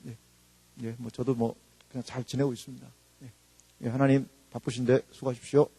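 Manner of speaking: low, soft, offhand formality; mock perfunctory prayer. Churchgoer checking in with God, perfunctory and subdued. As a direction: mumble it like a dutiful check-in.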